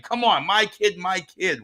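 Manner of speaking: lightly acted proud-dad protest; pushy dad pleading. Dad lobbying for his son, pleading insistently. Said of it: "all the things a dad would say".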